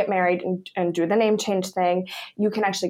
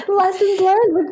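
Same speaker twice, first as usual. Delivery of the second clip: laughing; 11 semitones higher, slower than usual. Real, not acted. Laughing podcast joke.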